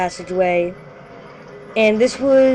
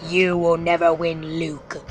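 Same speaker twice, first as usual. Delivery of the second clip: a hint of a menacing villain taunting; creepy voice; 4 semitones lower than usual. Convicted killer taunting Luke in court, lightly creepy. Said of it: "in, like, a creepy voice".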